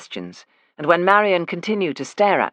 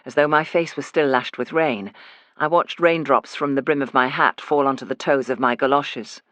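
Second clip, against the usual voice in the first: ordinary delivery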